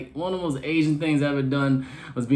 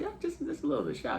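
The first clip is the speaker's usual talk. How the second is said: high bashful reply; 10 semitones higher, 10 dB quieter, faster than usual. Retold or imitated. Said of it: "I said".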